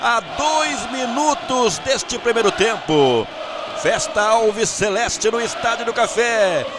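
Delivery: roaring, high goal call. Real, not acted. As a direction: erupt into the goal shout. Goal scored, commentator erupts.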